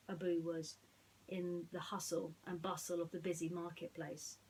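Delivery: put-on bright read-aloud story voice for children; lively storybook reading; storytelling narration. A narrator's reading. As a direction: tell it like a bedtime storybook.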